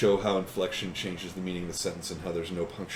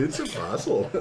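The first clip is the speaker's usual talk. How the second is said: teacher voice; 5 semitones higher, slower than usual. Retold or imitated. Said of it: "That's the third grade teacher right there".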